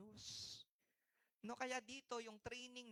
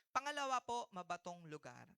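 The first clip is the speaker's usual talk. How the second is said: usual speaking voice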